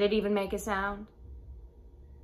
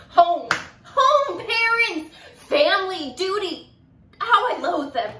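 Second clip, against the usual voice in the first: loud, high cry of disgust; loathing tirade; 9 semitones higher, 8 dB louder, slower than usual. Acted. Young woman railing against home and family duty, loathing tirade. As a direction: hurl each word out with loathing.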